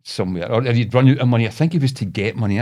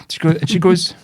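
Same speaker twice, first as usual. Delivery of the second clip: ordinary talk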